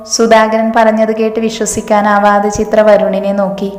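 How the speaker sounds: ordinary delivery, nothing marked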